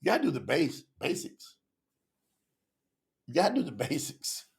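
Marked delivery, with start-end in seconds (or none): 1.0-1.6 breathy whisper of the word basics; whispered aside. Acted. Host whispering basics to viewers. 3.3-4.5 laughing repeat. Real, not acted. Host laughing while urging viewers on basics.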